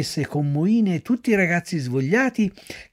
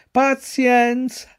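Pazienza higher and very slow, drawn out; resigned, chewing; 9 semitones higher, 3 dB louder, much slower than usual. A narrator's reading. Pinocchio chewing straw, sighing resignedly. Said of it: "ripeté continuando a masticare".

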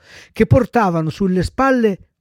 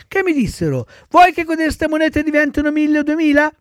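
put-on high-pitched little-boy storytelling; chattering child puppet; 6 semitones higher, 3 dB louder than usual. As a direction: recount it like an excited child. Voices Pinocchio telling his adventure. (burattino che racconta, voce acuta)

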